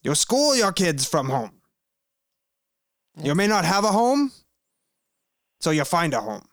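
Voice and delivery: hammed-up Bernie Sanders rally voice; Bernie Sanders impression. Shown as a demonstration. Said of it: "I'll do my Bernie Sanders".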